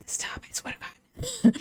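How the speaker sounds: barely-there whisper; hushed, exasperated whisper; whispered aside. Real, not acted. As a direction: whisper it under your breath.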